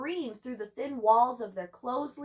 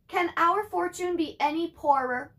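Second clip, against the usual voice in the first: sighing mother; 6 semitones higher, 4 dB louder than usual. Acted. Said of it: "Ma sighed".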